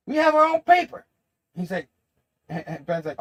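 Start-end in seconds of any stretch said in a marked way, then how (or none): 0.1-1.0 high, loud retort; mock indignant shout. Jonah answers the idea of a show, loudly indignant. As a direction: bellow it back as the boss.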